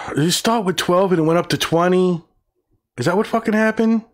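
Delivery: high-pitched, indignant disbelief; incredulous. Real, not acted. Reader finding the count went from twelve to twenty, incredulous. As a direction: question it in rising, high-voiced disbelief.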